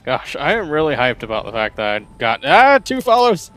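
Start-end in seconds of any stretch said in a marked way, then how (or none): 2.4-3.5 high, loud, thrilled; excited squeal. Real, not acted. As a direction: squeal it with delight. Hyped about new followers.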